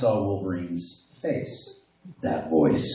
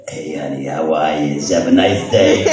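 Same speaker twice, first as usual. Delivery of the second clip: put-on cartoonish voice, growing louder; character voice; 3 semitones lower, 8 dB louder than usual. Boyfriend walking in the door, playful character voice. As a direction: greet your partner in a silly character voice. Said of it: "The voices do come out".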